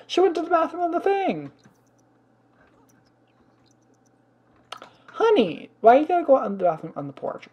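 high, loud, fast exasperation; exasperated outburst. Real, not acted. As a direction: scold the dog in disbelief. Exasperated at dog pooping.

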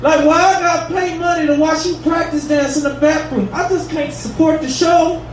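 normal talking voice

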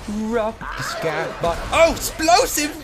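high, loud and slow hype; excited cheer. Real, not acted. Excited about item.

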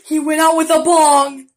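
loud burst of revelation; delighted loud discovery; excited punchline. Pretend. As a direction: blurt the pun loudly. Sudden pun after a gasp.

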